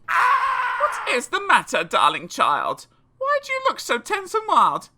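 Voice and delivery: a worried mum at the bedside; fussing mother. Acted. Mother voiced asking child.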